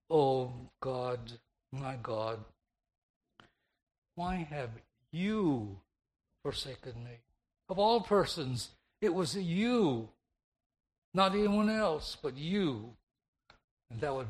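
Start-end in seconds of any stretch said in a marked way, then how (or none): 0.1-7.2 single out "you" with a long, falling emphasis; stress on "you". Shown as a demonstration. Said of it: "shifting the emphasis to the pronoun "you". 9.0-12.9 high, loud insistence, landing long on "you"; stress on "you". Shown as a demonstration. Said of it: "emphasis to the pronoun "you".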